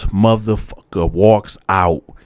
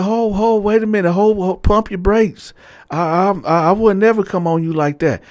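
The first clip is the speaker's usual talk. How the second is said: lightly voiced flustered old man, pitched higher; flustered cellmate; 9 semitones higher than usual. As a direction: play him caught out and apologizing, higher up.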